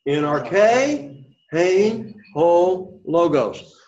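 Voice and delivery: separated Greek words in a lifted voice; call-and-repeat reading. Shown as a demonstration. Teacher has class repeat the Greek, call-and-repeat reading. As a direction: chant it word by word for the class. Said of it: "Say it again".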